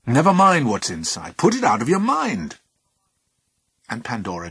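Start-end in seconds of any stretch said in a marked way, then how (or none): 0.1-2.6 loud, high stern warning. Acted. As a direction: shut the question down. Husband scolding.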